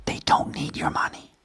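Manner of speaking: breathy conspiratorial whisper. Acted. Host whispering a secret to his viewers, hushed. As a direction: let them in on a secret, whispering.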